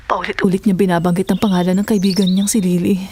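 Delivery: ordinary talk, nothing marked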